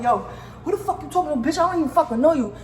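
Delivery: fast, high, heated retort; snapping back at her, fired up; retold angry yell. Yelling at the customer who stepped to her, fast and high. Confronting the girl in locker room.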